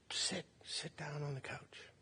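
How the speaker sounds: soft, nearly whispered retold words; hushed motherly invitation. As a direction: hush it like a mother settling a child. Mother comforts crying child.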